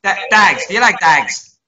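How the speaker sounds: playful Irish impression, lightly done; mock Irish accent. Co-host joins in mocking Irish voices, with a mock Irish accent. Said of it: "I, I can do it as well".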